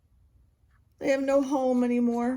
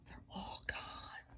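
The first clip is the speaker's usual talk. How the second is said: whisper; 23 dB quieter, slower than usual. Real, not acted. Last tree falls, hushed.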